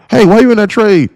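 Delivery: high nagging newbie voice; mock newbie question. Streamer imitating Discord members, high nagging newbie voice. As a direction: needle like a pushy beginner.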